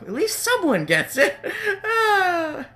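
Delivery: high, drawn-out cry of delight; overjoyed, hammed-up; gushing relief. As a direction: gush it and finish on a high squealed sigh. Voicing gushing character.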